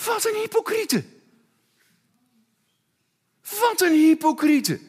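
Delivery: hoog, fel verontwaardigd. Real, not acted. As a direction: spreek je afkeer uit. Verontwaardigde uitroep. (verontwaardigd hoog uitgeroepen)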